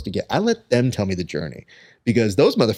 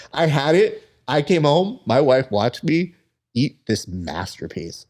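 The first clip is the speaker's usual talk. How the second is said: usual speaking voice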